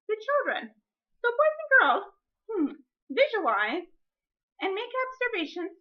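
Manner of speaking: bright high teacher voice calling the class; sing-song classroom voice; teacher voice to children. Shown as a demonstration. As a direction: raise your pitch to address the kids. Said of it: "teacher addressing class".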